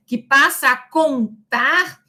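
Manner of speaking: normal talking voice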